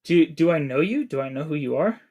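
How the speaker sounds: normal talking voice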